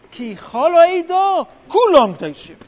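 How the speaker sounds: loud, slow rallying proclamation; proclaiming Korach. Retold or imitated. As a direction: call it out to the crowd like Korach. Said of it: "And they say".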